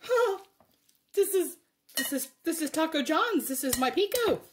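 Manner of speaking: high, delighted realization; amazed discovery. Retold or imitated. Retelling the moment she tasted her pico, high amazed. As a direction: gasp at your own salsa, pitch way up. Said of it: "I was like".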